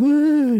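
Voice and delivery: high, loud wavering whoop; imitated wail. Clay creatures cry as they are destroyed, wailing. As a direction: wail like tiny doomed creatures.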